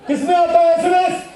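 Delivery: high loud quiz shout; calling out. Real, not acted. Teacher quizzes the students, loud and high. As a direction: fire the question at the class.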